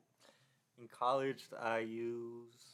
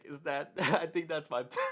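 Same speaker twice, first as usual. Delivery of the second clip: laughing; 5 semitones higher, 7 dB louder than usual. Retold or imitated. Retelling confronting the professor, laughing.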